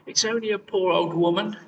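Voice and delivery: a hint of a squeaky old crone at the door. A narrator's reading. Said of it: "came a squeaky voice".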